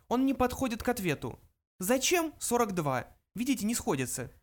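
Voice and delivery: high little mouse voice; high-pitched small creature. A narrator's reading. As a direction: answer matter-of-factly as a tiny mouse. Mouse Frankie answers.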